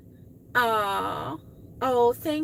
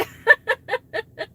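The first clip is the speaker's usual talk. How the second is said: laugh; 11 semitones higher, 8 dB louder than usual. Real, not acted. Laughing at herself.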